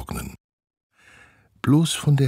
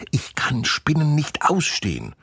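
put-on disgusted girl; 11 semitones higher than usual. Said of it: "verzieht das Gesicht".

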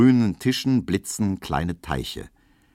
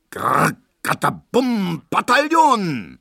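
high, slow, loud oompah noises; mock brass band; 10 semitones higher, 5 dB louder, slower than usual. Narrator mimics the band booming through the rain, hammed-up. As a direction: blare it out like a drum and trombone. Mimicking the band's patriotic potpourri.

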